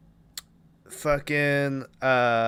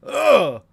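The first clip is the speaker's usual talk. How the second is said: heart attack groan; 10 semitones higher, 6 dB louder than usual. Retold or imitated. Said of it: "Colonel Cochran goes".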